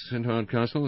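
ordinary delivery, nothing marked

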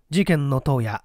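ordinary delivery

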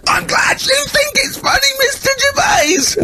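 very high, slow, wounded sarcasm; bitter teacher. Retold or imitated. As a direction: spit it out shrilly before storming off. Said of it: "He went".

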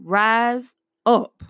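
ordinary talk, nothing marked

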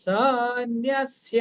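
সন্ন্যাস্য said slowly with a doubled ন; pure Sanskrit pronunciation. Shown as a demonstration. Teacher giving the pure Sanskrit form. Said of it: "পূর্ণভাবে শুদ্ধ করলে উচ্চারণ কি হচ্ছে".

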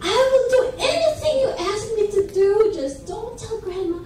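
high, rushed acted bargaining; desperate pleading. Brother bargains desperately with sister.

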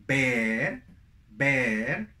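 "bear" with eh, rising as in a list; contrast drill. Shown as a demonstration. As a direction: lift the end of "bear". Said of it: "Así sería como se tiene que hacer". ("bear" con E, entonación ascendente)

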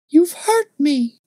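put-on high little girl's voice, slow and hurt; childish reproach. A narrator's reading. Little girl tells the man he hurt her, high and reproachful. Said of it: "said a childish voice, reproachfully".

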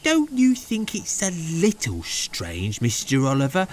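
high-voiced, wondering brake van; puzzled, careful questioning; observing. A narrator's reading. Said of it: "observed Toad".

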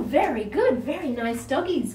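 normal talking voice

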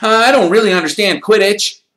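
put-on high, loud breathless eager boy. A narrator's reading. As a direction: rush out the words in wonder. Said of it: "said Colin breathlessly".

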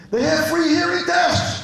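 slow, high, loud call to guys thirty yards away; calling out across the store. Retold or imitated. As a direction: shout it across the shop, drawn out. Shouting across to the register.

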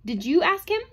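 loud, high rising question; sudden sharp challenge; shot at him. A narrator's reading. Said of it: "she shot at Bogart".

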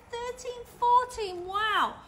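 ordinary delivery, nothing marked

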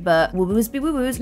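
normal talking voice

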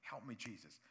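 soft, low pleading prayer; quiet prayer. Retold or imitated. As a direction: pray it humbly, hushed. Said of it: "come before God and say".

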